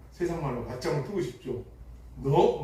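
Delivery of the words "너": a short loud half-acted "너?"; mock angry snap. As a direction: snap at the younger brother, only half in earnest. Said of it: "막 이렇게 화를 내고 싶은데".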